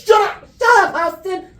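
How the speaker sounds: laughing as the annoyed mom; imitating Mom. Son imitating his mom telling him to shut up. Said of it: "She goes".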